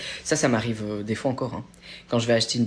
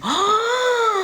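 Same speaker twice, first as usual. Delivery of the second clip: long, high gasp of delight; excited gasp; 5 dB louder than usual. Real, not acted. Streamer reacting to a friend on holiday, excited gasp. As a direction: gasp loudly in happy surprise.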